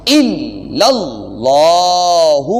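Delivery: الا اللہ with the doubled لام pressed and the second لام drawn long; slow recitation. Shown as a demonstration. Teacher chants الا اللہ slowly for students. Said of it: "اور لمبا لام کو کیا ہے".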